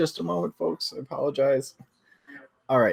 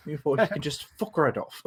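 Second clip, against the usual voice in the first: higher, playful put-on British accent telling folks off; mock British accent; 5 semitones higher than usual. Co-host tells rude chat off in mock British accent. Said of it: "appropriate for our, uh, British location in the book".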